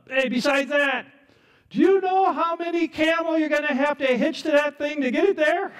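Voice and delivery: high, loud taunting; mocking. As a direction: heckle him with an exaggerated, high-pitched jeer. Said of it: "They're scoffing, mocking him".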